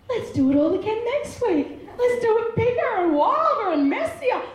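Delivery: high, playful excitement; gleeful father. Acted. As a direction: egg them on with mischievous delight. Father gleefully answering complaining son.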